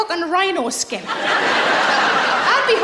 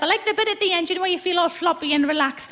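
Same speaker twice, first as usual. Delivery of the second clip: ordinary talk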